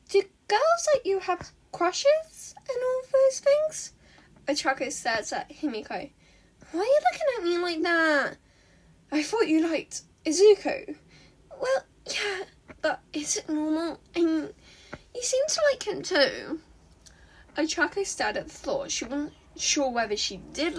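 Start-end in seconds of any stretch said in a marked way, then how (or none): 0.1-3.9 much higher, slow and unsure; hesitant high girl, a little teary; Himiko's voice. A narrator's reading. Himiko asks shyly, high voice. 6.7-16.6 put-on high girl voice. A narrator's reading. Himiko voiced high in story.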